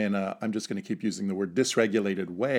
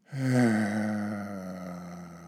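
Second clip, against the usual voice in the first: long sigh. Shown as a demonstration. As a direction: let a big sigh go. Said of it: "to make a sighing sound".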